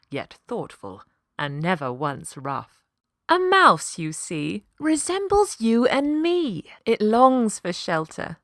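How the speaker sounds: usual speaking voice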